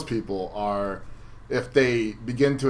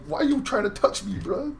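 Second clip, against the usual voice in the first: high-pitched, indignant whine; mock annoyance; 8 semitones higher than usual. Retelling refusing a handshake after losing, irritated. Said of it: "I get so mad".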